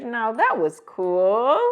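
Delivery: ordinary delivery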